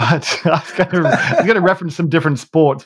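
laughing. Real, not acted. Confessing a nearly made sports joke, laughing. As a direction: barely get it out for laughing.

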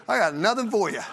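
put-on playful kid defiance; playing the stubborn kid. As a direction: dig in like a two-year-old. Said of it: "I don't think I'm any different than Joe".

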